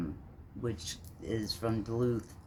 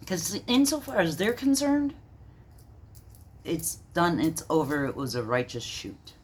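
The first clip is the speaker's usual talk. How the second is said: much higher, disbelieving challenge; incredulous outrage; 9 semitones higher than usual. Real, not acted. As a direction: demand an answer, scornful. Outraged at official story.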